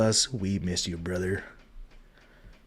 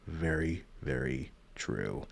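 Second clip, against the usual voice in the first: mock deep agreement; 7 semitones lower, 12 dB quieter, slower than usual. Echoing a chat comment, mock agreement.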